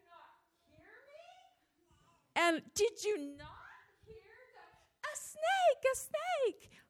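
shrieking at him in a snake panic; panicked. Retold or imitated. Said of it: "and I'm like".

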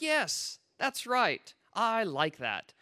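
high-pitched, gleeful agreement; mock cheering along. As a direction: start high on yes, then come down. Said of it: "I'm cheering along with this so far".

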